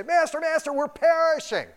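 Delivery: high, loud mock panic: Master, Master; frantic plea of sailors in the storm; panicked cry.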